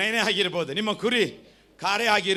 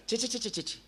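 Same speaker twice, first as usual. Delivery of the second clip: repeated disgusted ಛೆ, a bit higher and softer; mock disgusted tutting; 5 semitones higher, 7 dB quieter than usual. Preacher mimicking a churchgoer who sees impurity, mock disgust. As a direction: tut tut like spotting something filthy. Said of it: "ಅಂತೀರಾ ಅಲ್ವಾ?".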